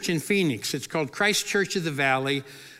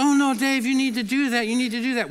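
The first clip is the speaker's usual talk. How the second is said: higher-pitched imitation of pushy colleagues; mock insistence; 7 semitones higher, 3 dB louder, faster than usual. Colleagues urging Dave to skydive, imitated as insistent. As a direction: press him, imitating the whole group. Colleagues pressing him, retold.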